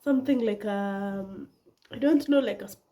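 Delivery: usual speaking voice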